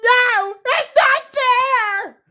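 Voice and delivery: very high, drawn-out mock wail; crying tantrum. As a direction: mimic a squealing tantrum. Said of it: "her crying or her tantrum".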